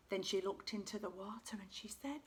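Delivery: ordinary delivery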